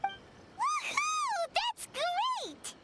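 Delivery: high, gleeful whoop; cheering. Acted. Cheering when the editor says the novel sells. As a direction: burst out with joy at the news.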